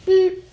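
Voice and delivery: very high electronic beep; stud finder beep. Retold or imitated. Imitating stud finder beeping.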